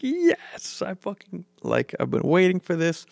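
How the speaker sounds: high, breathy yell of triumph; excited cheer. Retold or imitated. Fan cheering as Superman shoots skyward, excited. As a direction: throw your arms up and cheer. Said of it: "I, like, shot both of my hands in the air".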